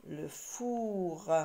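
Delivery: "four" with a long held vowel; slow, lingering "four" for learners; drawn-out word. Shown as a demonstration. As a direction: stretch out "four". Teacher sounding out the word.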